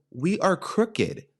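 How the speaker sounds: We are crooked said slowly, each word weighted; drawn-out, disbelieving statement of the obvious; slow, deliberate disbelief. Retold or imitated. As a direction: spell it out slowly to him, high and incredulous. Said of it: "I was like".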